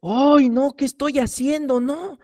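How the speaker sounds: high-pitched mock panic, lightly done; a sudden "oh no" as it hits you; retelling a moment of horror mid-sermon; alarmed realization. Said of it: "me cayó así como un balde de agua fría".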